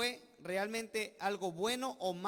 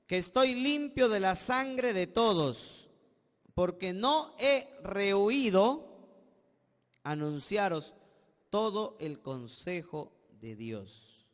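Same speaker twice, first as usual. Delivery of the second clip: solemn scripture reading; 5 dB louder than usual. Acted. Reads scripture with force.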